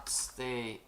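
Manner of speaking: ordinary talk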